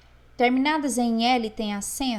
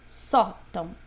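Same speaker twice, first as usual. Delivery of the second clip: Sótão with a firm SÓ and a light TÃO; stress on SÓ; 3 semitones lower than usual. Shown as a demonstration. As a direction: lean on the first syllable of Sótão. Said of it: "pra ele ficar mais forte". (Sótão, destacando o só)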